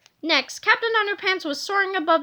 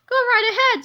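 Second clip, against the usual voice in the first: higher, dismissive go ahead; pitched-up smug permission; Melvin voice; 8 semitones higher, 4 dB louder than usual. A narrator's reading. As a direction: wave him off. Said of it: "said Melvin Sneedly".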